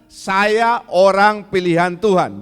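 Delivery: slow, loud declaration; congregation repeat line. Shown as a demonstration. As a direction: proclaim it for the church to repeat.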